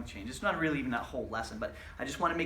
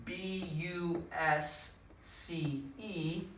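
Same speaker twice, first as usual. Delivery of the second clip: letters spelled slowly, one by one; 7 dB quieter, much slower than usual. Shown as a demonstration. Teacher to class, spelling the wrong form slowly. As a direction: spell each letter out deliberately. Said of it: "you got a problem".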